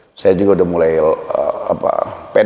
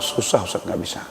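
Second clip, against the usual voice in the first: mock limp, much softer whine; mock weak whine; 5 semitones lower, 10 dB quieter than usual. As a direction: imitate a man who can't cry, voice sinking low. Mocking a man who cannot cry.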